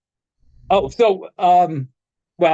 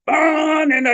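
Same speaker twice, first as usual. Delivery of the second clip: mock shout; 10 semitones higher, slower than usual. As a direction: bark out the title with scorn. [name] mocking [name]'s song title.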